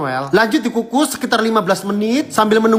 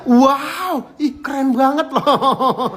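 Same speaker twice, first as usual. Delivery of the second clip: excited amazement; 7 semitones higher, much slower than usual. Real, not acted. Amazed at the dessert.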